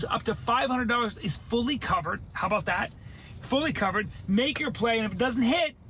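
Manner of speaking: energetic, chatty sponsor pitch; ad read. Real, not acted. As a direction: sell it like an excited host.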